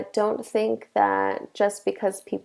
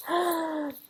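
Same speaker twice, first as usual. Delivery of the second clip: a playful, staged gasp of surprise; mock gasp; 9 semitones higher, 7 dB quieter than usual. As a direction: gasp as if a student guessed it. Teacher reacts to imagined guess.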